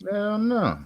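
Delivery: a sharp, high-pitched hell no; indignant high yelp of refusal; emphatic no. Real, not acted. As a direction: fire it back, no way in the world. Emphatic refusal about Sammy.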